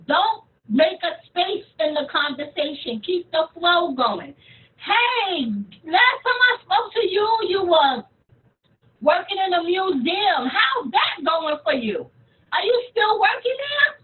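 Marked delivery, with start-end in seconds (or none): 4.8-14.0 raised, friendly catch-up questions; upbeat. Shown as a demonstration. Coach demonstrating asking an old friend about work, upbeat. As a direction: keep the chat flowing brightly, pitched up.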